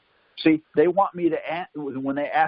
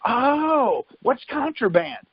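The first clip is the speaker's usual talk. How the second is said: high, slow feigned innocence, Oh stretched up high; mock-innocent question, pitched high and drawn out; 8 semitones higher, much slower than usual. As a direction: play dumb to needle the cop. Playing dumb with the cop.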